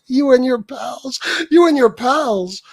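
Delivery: high, loud, drawn-out jeer; mocking echo. Streamer ridiculing the threat to his pals, high mocking echo. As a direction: repeat it slowly in high-pitched derision. Mockingly repeats JC's phrase.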